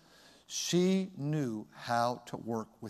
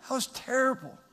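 voice breaking; 11 semitones higher than usual. Real, not acted. Preacher to the congregation, admitting how spanking hurt him, voice breaking. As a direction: voice cracking up high, holding back tears. Recalling spanking his first child.

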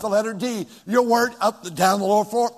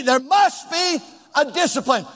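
ordinary talk

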